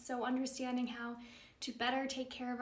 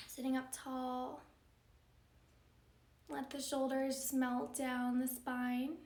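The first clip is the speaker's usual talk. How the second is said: softer, unhurried meditation voice with long pauses; calm, measured yoga-teacher meditation delivery; guided meditation; 6 dB quieter than usual. Acted. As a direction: guide them gently into stillness, leaving space between phrases.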